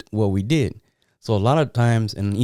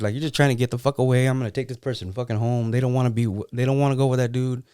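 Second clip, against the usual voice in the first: ordinary delivery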